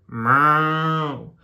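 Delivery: long drawn-out "meow" noise; sustained vocal sound effect; imitated meow. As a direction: stretch out a cartoon meow. Spelt story sound effect.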